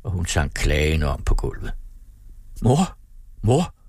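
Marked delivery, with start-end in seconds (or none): 2.6-3.8 high-pitched, mildly tearful calling; tearful cry. A narrator's reading. Daughter calls to her collapsed mother, tearful cry. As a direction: call out with a touch of tears. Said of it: "råbte Karen grædende".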